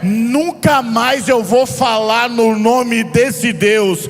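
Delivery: preaching at full force. Acted. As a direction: preach it with fire to the congregation. Preaching builds to shouting.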